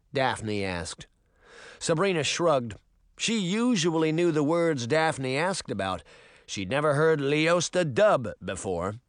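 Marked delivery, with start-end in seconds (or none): none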